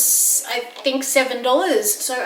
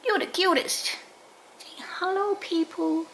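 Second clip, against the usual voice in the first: higher, softer coo; 7 semitones higher, 8 dB quieter than usual. Real, not acted. Owner cooing at her cute dog, higher and soft. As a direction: coo at the puppy.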